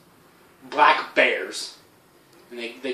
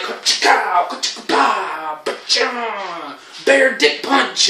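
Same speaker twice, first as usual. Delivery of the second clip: high, loud karate sound effects, slowing at the punch; hammed-up kung fu noises, drawn out on the last punch; mock fight noises; 7 semitones higher, 6 dB louder, much slower than usual.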